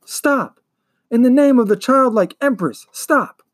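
high, urgent commanding shout. Acted. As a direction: call out a desperate order at the top of your lungs. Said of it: "shouted at the top of his lungs".

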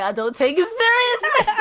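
high, loud voice breaking into laughter; cracking up. Real, not acted. Streamer mocking Uchiha fans, cracking up. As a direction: laugh your way through it.

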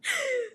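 a high laugh tumbling down; falling giggle. Real, not acted. Remembering friends' faces, falling giggle. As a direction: giggle and let it fall.

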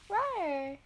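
short zombie growl; zombie moan. Pretend. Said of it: "to do zombie noises".

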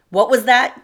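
loud, high, harsh demand; rough. A narrator's reading. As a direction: bark it down at her, threatening. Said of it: "Dennis's voice was rough".

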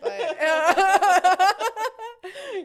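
giggling mid-question, pitch jumping up; fighting back laughter while asking; asking through giggles; laughing through it. Real, not acted.

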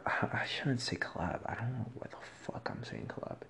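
self-conscious whisper to herself; whispered aside. Real, not acted. Host corrects her own word choice, whispering to herself. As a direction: breathe it out as a private aside.

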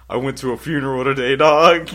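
loud, high quote rising to the last word; imitated buddy confessing. Buddy admitting his excuse for work, mock loud confession.

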